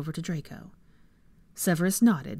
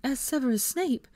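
high-pitched, exasperated woman's voice; annoyed protest; 11 semitones higher than usual. A narrator's reading. Hermione objecting to Snape, exasperated. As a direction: pitch climbing until cut off.

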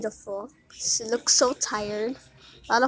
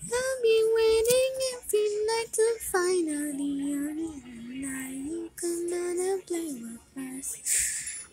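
high pet voice; 7 semitones higher, 3 dB quieter than usual. Real, not acted. Talking sweetly to the cat.

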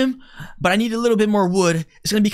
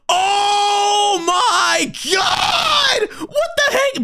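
high startled shout; shocked cry; 9 semitones higher, much slower than usual. Real, not acted. Monster in a plane.

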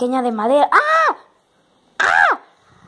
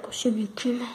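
whisper; 8 dB quieter than usual. Real, not acted. Close call with fire, repeated.

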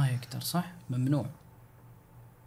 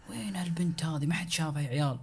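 whisper; 9 dB quieter than usual. Pretend. Voices Mr X searching.

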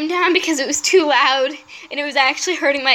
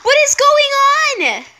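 高く大きな叫び; 攻撃されてパニックで叫ぶ; panicked yelling; 8 semitones higher than usual. Real, not acted. Panicking among dragons.

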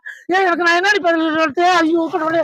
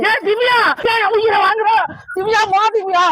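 higher, raised pleading call; exasperated pleading call; 4 semitones higher than usual. Acted. Husband begging Divya to go to hospital, exasperated call.

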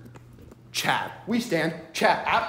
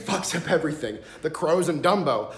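ordinary talk

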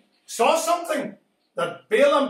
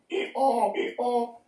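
donkey bray; 3 dB quieter, slower than usual. Retold or imitated.

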